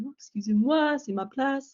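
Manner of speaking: put-on flustered girl asking a businessman for her seat; polite French apology. Retold polite request on train.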